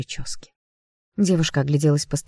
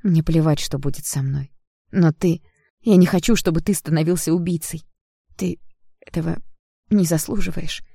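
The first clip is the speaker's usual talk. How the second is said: higher plea in broken bursts; abrupt, halting; 3 semitones higher than usual. A narrator's reading. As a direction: break the plea into separate jerky pieces. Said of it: "отрывисто произнесла девушка".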